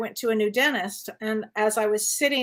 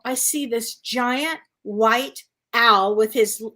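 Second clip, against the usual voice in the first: ordinary delivery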